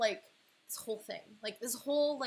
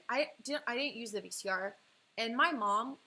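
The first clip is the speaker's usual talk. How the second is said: ordinary talk, nothing marked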